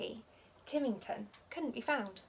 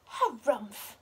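louder huffy harumph; grumpy harumph; 6 dB louder than usual. A narrator's reading. Pom Pom fed up at breakfast, huffy harumph. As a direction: huff at the soggy cereal. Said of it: "said Pom Pom".

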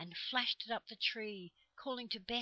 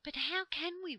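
high, eager stage whisper; 10 semitones higher, 7 dB louder than usual. A narrator's reading. Children whisper back to the trees, eager and high. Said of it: "whispered back the children eagerly".

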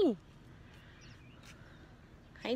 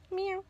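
cat meow; 11 semitones higher than usual. Acted. Meowing at the cat.

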